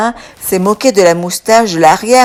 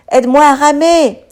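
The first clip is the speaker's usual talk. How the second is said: ordering; 7 semitones higher than usual. A narrator's reading. Lynn commanding Pic on the branch, urgent order. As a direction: bark the order to row. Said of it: "ordonne Lynn".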